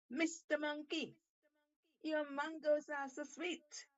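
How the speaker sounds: high, barked shark voice; barking shark. Acted. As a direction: bark it out as the eager shark. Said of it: "barked Shark".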